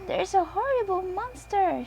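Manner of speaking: Peppa snorts it out, high; high little piggy voice, snorting in alarm; young piglet snorting at a monster. A narrator's reading. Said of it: "she snorts".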